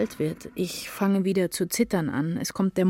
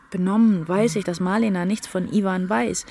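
ordinary talk, nothing marked

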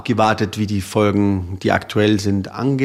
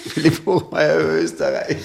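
laughing; 6 semitones higher than usual. Real, not acted. Guest echoes host's title, laughing.

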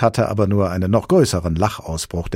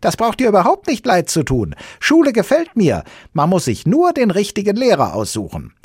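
high, louder creature voice; Sams explaining; 8 dB louder than usual. Acted. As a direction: explain eagerly in a squeaky voice.

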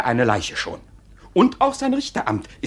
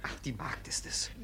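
whisper; 15 dB quieter than usual. Acted. Relief, hushed aside.

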